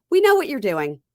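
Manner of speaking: we see right through you, said loud and high; knowing mock accusation. Family calling out her accent game, loud knowing tease. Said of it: "all were watching this going".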